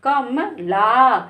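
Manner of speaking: "कम ला" slowly, with म said softly and short. Shown as a demonstration. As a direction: say "कम ला" gently, barely touching म. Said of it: "माँ को धीरे से बोला". ("कम ला" में म को धीरे से, धीमे बोलें)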